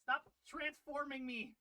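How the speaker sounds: mock outrage. Real, not acted. Mock angry at chat.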